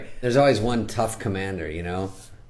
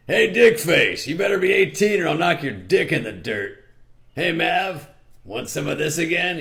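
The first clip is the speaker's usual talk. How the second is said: higher, rough-edged bouncer taunt; tough bouncer; 9 semitones higher than usual. Acted. Bouncer taunting Maverick at the door, rough tough-lady voice. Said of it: "she's the bouncer".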